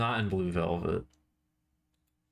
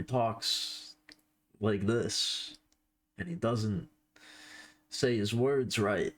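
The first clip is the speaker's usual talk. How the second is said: stilted, slow delivery broken by odd pauses; badly faked actor voice, halting between phrases; Christopher Walken; much slower than usual. Streamer showing viewers how Christopher Walken talks, stilted and slow. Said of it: "stilted quality to his voice".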